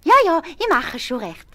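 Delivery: high, loud eager wife; wife's voice. A narrator's reading. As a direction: brush him off, eager to agree. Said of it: "sagt die Frau".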